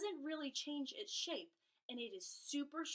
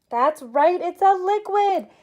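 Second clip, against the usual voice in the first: loud, high, cheerful praise; enthusiastic praise; 6 semitones higher, 23 dB louder than usual. Acted. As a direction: cheer like a kids show host. Praising pretend answers from kids.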